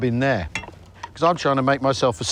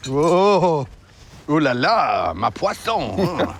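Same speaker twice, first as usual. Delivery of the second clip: hammed-up French chef accent, slow; much slower than usual. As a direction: ooh la la like a cartoon French cook. Tastes French food, hams French.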